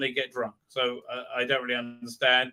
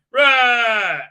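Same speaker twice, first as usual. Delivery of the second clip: loud, high "raaah" yell; mock Hulk roar; 7 semitones higher, 10 dB louder than usual. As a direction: roar as if heaving a boulder, hammed up. Imitating Hulk throwing a boulder.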